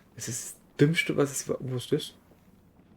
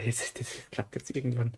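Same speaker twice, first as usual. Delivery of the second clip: soft unvoiced whisper; 7 dB quieter than usual. Real, not acted. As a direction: breathe it to yourself. Quietly encouraging himself.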